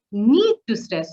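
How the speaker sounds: "need" and "stress" punched, said slowly; stressed words. Shown as a demonstration. Trainer shows emphasis while teaching, stressing "need to stress". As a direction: lean hard on "need to stress". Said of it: "like now just I said".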